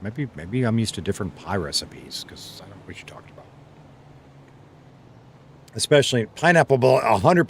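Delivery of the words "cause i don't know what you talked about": fast, soft whispered aside; a quick whisper to yourself. Real, not acted. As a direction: breathe it under your breath, quickly. Mumbled aside after reading chat.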